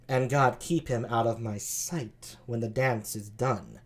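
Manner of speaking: low, slow, soft sultry voice; seductive, unhurried low tones. Acted. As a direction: play her low, languid and sultry. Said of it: "sultry voice like that".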